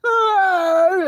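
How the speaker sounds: long, high, loud "ah" wail; anguished wail. Retold or imitated. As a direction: let out a big acted cry of anguish. Acted cry while anxious.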